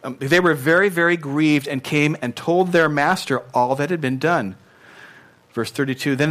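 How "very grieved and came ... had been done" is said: ordinary talk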